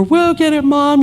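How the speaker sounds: a hint of a happy five-year-old; little girl voice. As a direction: chirp it like a small child running to the door. Little fairy girls at door.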